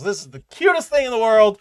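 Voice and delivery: Kara gushing over Cream. Retold or imitated. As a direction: squeal over how cute it is.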